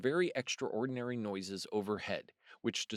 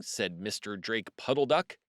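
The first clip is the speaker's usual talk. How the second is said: ordinary delivery, nothing marked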